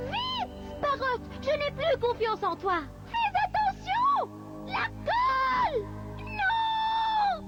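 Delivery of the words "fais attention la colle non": high, slow alarmed cry; urgent warning shout. Acted. Warning a friend of danger.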